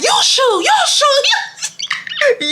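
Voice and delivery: fast, squealing mock yell; childish shrieked comeback, breaking into laughter; imitated yelling back. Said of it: "She yells back".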